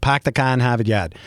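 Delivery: easy Boston accent, R's dropped; real Boston accent. Shown as a demonstration. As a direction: say it like a native Bostonian. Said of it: "That's the way to do it".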